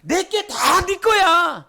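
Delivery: God telling the believers everything is theirs, slow and tender; tender reassurance. Acted. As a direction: say it like a loving father's gift. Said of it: "우리에게 뭔 얘기를 했냐면".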